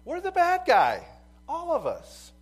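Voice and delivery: loud, driving preacher's cry; full-voiced revival preaching; preaching at full force. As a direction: preach it out to the whole room. Preaching climax.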